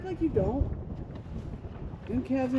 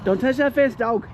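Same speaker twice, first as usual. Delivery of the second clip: firm command; 3 semitones lower, 5 dB louder than usual. Real, not acted. Warning dog off the fence.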